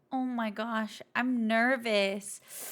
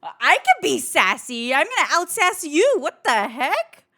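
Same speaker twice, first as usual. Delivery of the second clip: louder playful sass; sassy; 7 dB louder than usual. Pretend. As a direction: put on a sassy attitude. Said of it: "Why aren't you being more sassy".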